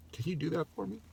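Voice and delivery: a real lump in the throat on the plea; getting emotional. Real, not acted. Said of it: "I almost got emotional there".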